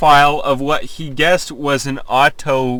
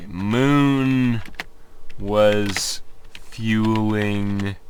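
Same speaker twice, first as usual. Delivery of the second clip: halting slow read; 8 semitones lower, 6 dB quieter, much slower than usual. Shown as a demonstration.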